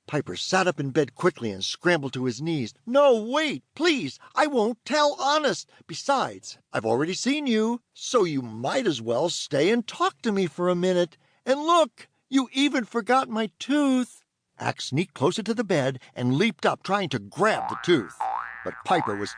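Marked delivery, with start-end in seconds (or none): 2.9-14.2 put-on high, pleading kid voice; a boy begging the fairy to stay; eager young boy.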